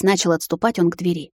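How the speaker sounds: ordinary delivery, nothing marked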